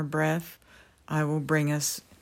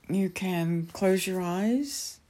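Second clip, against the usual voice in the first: guided meditation. Acted. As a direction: ease them gently into calm.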